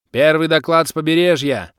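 high, loud announcement; shouted report. A narrator's reading. Clan player reporting from the coast, shouting. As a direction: call the report out. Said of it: "выкрикнул неизвестный мне игрок".

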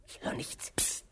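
unvoiced hushed whisper; tense listening whisper. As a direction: hush while listening. Listening for a sound.

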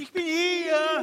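faint voice calling from far off in the dark; distant call. Acted. Lost man calling from far away in the tunnel, faint. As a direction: call out from a distance, slow and breathy.

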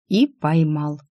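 plain reading voice

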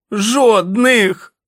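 very high, very loud yell; hoarse shout. Acted. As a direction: scream the word, voice cracking. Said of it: "кричав він охриплим голосом". (охриплий несамовитий крик)

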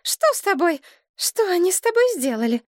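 high, distressed outcry; frantic, high-pitched concern; alarmed exclamation. A narrator's reading. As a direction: exclaim in horror over a loved one. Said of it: "опустилась на колени и воскликнула".

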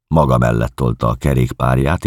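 usual speaking voice

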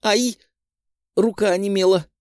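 high yelp at the shock; being electrocuted. A narrator's reading. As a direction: cry out as the current hits. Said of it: "прикрикнула девушка и отскочила".

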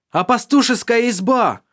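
high loud shout; shouting over wind. Acted. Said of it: "крикнул Доминик, пытаясь перекричать шум ветра".

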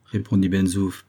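ordinary delivery

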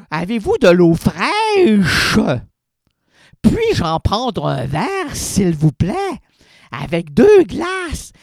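loud, slow, exaggerated diction; over-articulated politeness. Shown as a demonstration. Quebecer abroad ordering water, loud and slow, overly articulated. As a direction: spell out each syllable to a foreign waiter. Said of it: "Tu vas dans un autre pays francophone, articule".

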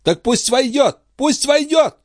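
high, urgent order; agitated command. A narrator's reading. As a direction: order it insistently, pitched high. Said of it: "приказала королева". (взволнованный приказ высоким голосом)

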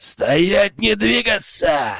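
slow, booming ambush challenge; thundering command. A narrator's reading. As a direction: shout them to a halt. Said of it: "прогремел знакомый голос".

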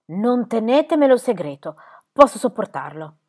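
loud, trembling insistence; anguished plea. A narrator's reading. Squire pleading not to be spared, loud anguish. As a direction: demand the truth through fear. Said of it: "tremando per l'angoscia".

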